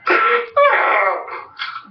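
shrill, squealing burst of laughter; high cackling laugh. Real, not acted. Vlogger cracking up at the end of his rant, in a high squealing cackle.

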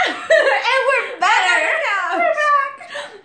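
high laughing cheer. Real, not acted. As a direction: laugh through the words. Laughing return to couch.

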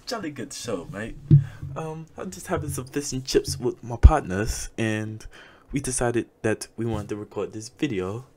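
loud, high hammed-up British toff voice; mock British accent. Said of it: "I don't think they say that".

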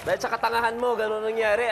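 usual speaking voice

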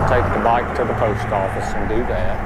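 usual speaking voice

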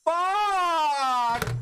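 raging gamer. Real, not acted. Raging at the game.

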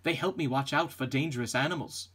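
ordinary talk

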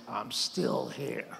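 slow, soft and knowing; quiet triumph. Retold or imitated. Priest tells his doctor at the door, slow and quietly triumphant. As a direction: savour it, a quiet told-you-so.

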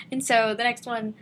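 ordinary talk, nothing marked

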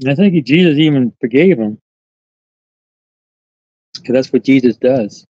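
ordinary talk

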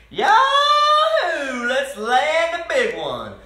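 high, loud, long yahoo whoop; exclaimed holler. A narrator's reading. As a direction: whoop with excitement, then holler the rest. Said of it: "every time he exclaimed".

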